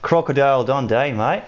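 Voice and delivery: put-on playful Australian accent, lifted after a laugh; amused, exaggerated Australian imitation; mock Australian accent. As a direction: ham up an Aussie "mate". Australian film impression after laughing.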